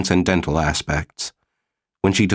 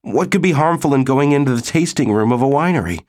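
protesting; 7 semitones higher, faster than usual. A narrator's reading. Disappointed Morley protests being stopped.

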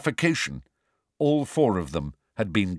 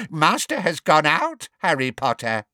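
higher elf voice, smug; sly elf; 6 semitones higher, 3 dB louder than usual. A narrator's reading. Elf taunts Harry about his absent master, smug. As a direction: taunt him as the house elf.

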